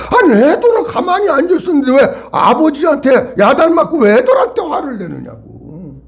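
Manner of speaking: high-pitched exasperated remonstrance, falling away at the end; indignant protest. Acted. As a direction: protest indignantly on the children's behalf. Indignant protest on children's behalf.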